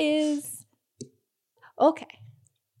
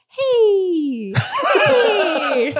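higher, coaxing hey with a laugh; 7 semitones higher, 5 dB louder than usual. Pretend. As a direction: coax the bee closer gently.

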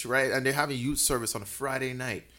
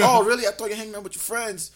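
suspicious mom; 8 semitones higher, 6 dB louder than usual. Retold or imitated.

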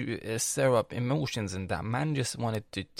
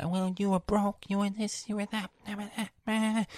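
high, whiny mock of the sister; 10 semitones higher than usual. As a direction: parody her nagging, pitched up. Mocking sister's accusations.

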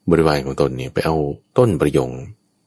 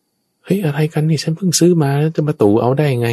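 outraged protest; 12 semitones higher than usual. Acted. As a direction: cry out at being robbed in public. Owner protests in quarrel.

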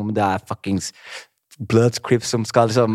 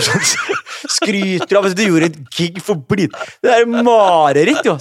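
laughing while telling; 9 semitones higher, 5 dB louder, slower than usual. Real, not acted. Laughing at the memory of bragging.

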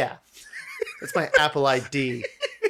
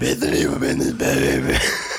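much lower, louder, sultry parody; deep sultry voice; 13 semitones lower, 4 dB louder than usual. Pretend. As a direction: drop way down and purr it. Repeats line in put-on voice.

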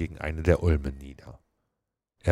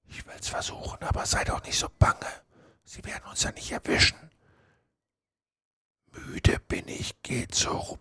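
soft, voiceless whisper; 8 semitones higher, 6 dB quieter than usual. Acted. Hiding boy reassures, starts praying.

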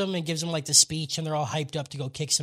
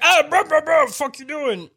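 startled guard; 8 semitones higher, 7 dB louder than usual. Retold or imitated. Guards catching the sneaking kids.

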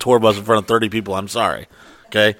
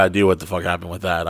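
ordinary talk, nothing marked

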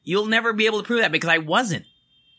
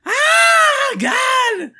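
loud higher exasperated Ah, God; cathartic shout; 6 dB louder than usual. Real, not acted. Venting relief after ranting about the client, shouted. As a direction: let it all out.

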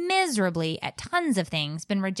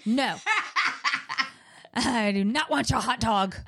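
laughing refusal; 5 semitones higher than usual. Retold or imitated. Mock refusal while laughing.

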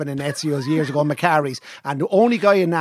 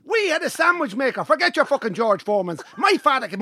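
excited boast; 5 semitones higher than usual. Real, not acted. Shouting proud boast.

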